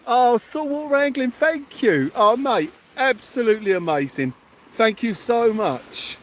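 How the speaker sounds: high, gushing thanks; overjoyed gratitude. Real, not acted. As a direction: thank them as if blown away. Overwhelmed thanks for a donation.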